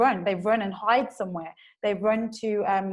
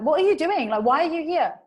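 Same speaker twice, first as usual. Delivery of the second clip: higher, louder angry challenge; raised-voice mock outburst; shouting at colleague; 7 semitones higher, 6 dB louder than usual. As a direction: act out snapping at a coworker. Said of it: "ends up shouting at this colleague".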